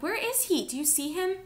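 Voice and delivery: very high, anxious voice; high-pitched worried cry; frantic questioning. A narrator's reading. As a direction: search desperately for him. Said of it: "Ivan shouted".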